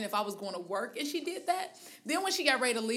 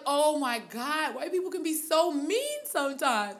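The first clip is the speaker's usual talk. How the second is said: slow, drawn-out, higher disbelief; 5 semitones higher, slower than usual. Retold or imitated. Woman retelling her own reaction on the train, slow drawn-out disbelief. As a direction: stretch it out in amused shock. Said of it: "I was like".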